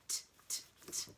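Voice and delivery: crisp voiceless T ticks; short, separate tongue-tip clicks; ticking watch. Shown as a demonstration. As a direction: tick evenly like a watch. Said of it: "Sounds like a watch".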